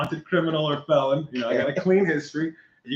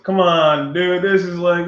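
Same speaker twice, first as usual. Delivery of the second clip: louder, higher protest; exasperated plea; 4 semitones higher, 7 dB louder, slower than usual. Retold or imitated. Driver to officer refusing sympathy, louder exasperated plea. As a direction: plead in frustration.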